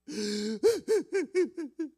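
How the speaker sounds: high, loud imitated wail of a sobbing mother; mock crying wail. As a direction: burst into a hammed-up wail. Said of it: "막 우는 거예요". (흉내 낸 울음소리)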